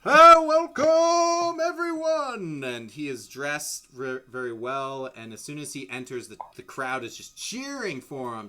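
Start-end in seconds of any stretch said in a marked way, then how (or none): 0.0-2.6 very high, loud, drawn-out arena shout; screaming announcer. Acted. Arena announcer greets the crowd, screaming and stretching the words. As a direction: belt it out slowly to a whole crowd. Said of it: "he starts screaming to the crowd".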